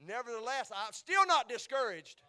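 high, loud declaration; defiant preaching shout. Real, not acted. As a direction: proclaim it loudly to the congregation.

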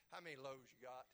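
low, soft sarcastic question with a laugh; a little sarcasm. Acted. Preacher voicing Jesus asking about the loaves, lightly sarcastic. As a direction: quietly needle them with mild sarcasm. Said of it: "read it with a little bit of, of sarcasm".

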